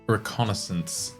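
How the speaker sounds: normal talking voice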